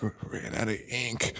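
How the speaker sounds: very soft, slow, fading; imitating dying man. Retelling a dying character's excuse to co-host, feeble mock-dying voice. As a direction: croak it out softly like a mock deathbed line. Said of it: "Why is he talking like that? ... 'cause he's dying".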